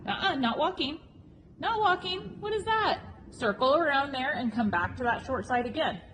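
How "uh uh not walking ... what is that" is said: high, exasperated calling out; sharp, rising disbelief. Real, not acted. Coach scolding horse stopping.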